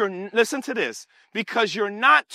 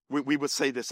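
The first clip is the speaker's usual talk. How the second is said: usual speaking voice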